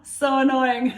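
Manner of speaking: high, laughing loudly. Real, not acted. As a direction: laugh it out at yourself. Laughs at herself.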